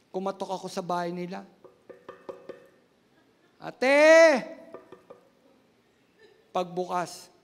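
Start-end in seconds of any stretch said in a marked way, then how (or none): 3.6-4.4 calling out. Retold or imitated. Calling at the neighbour's door.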